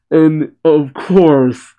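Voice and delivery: normal talking voice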